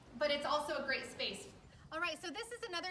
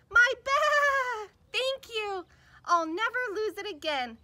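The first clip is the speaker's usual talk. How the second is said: high, loud, overjoyed shout; cheering; 7 semitones higher, 10 dB louder, slower than usual. Acted. Lamb thanking the other lambs, joyful cheer. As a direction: cheer like you got your voice back. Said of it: "cheered Little Lamb".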